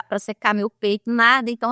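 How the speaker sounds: normal talking voice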